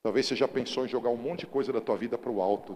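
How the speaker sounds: loud, driving sermon voice; fiery pulpit preaching; preaching at full force. Acted. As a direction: preach it out to the whole church.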